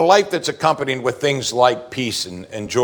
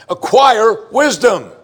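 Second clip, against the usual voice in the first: ACQUIRE WISDOM, slow, much higher and louder; insistent exclamation; 9 semitones higher, 6 dB louder, slower than usual. Acted. Preacher repeating David's familiar refrain, insistent exclamation. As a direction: punch both words as a drawn-out exclamation. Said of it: "and here we go again".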